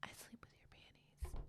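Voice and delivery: hushed, barely voiced creepy reply; whispered non sequitur. Man replying to her mental health plea, whispered. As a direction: whisper it as an absurd answer.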